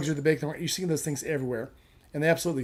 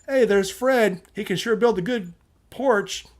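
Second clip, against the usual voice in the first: loud, higher mock greeting; mock cheerful hail; 6 semitones higher, 4 dB louder, slower than usual. Joke teller voicing a passer-by, loud mock hail. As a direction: call out Fred's name like a fan. Imagined passer-by hailing Fred.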